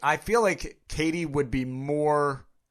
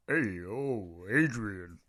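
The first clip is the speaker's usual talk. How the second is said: low, softer slurred boxer call; Sylvester Stallone as Rocky; 4 semitones lower, 4 dB quieter, much slower than usual. Acted. Taking a turn at the Rocky line, low Stallone impression. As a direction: drop the pitch and call it like a dim boxer. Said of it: "if you're doing a Sylvester St".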